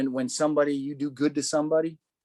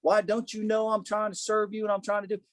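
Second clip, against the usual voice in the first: mock-frustrated griping at the Lord; retold angry complaint; 5 semitones higher than usual. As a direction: act out protesting to God without raising the volume. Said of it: "that anger right there".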